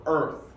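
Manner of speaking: EARTH with a strong R before TH; strong American R. Shown as a demonstration. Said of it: "with a strong R".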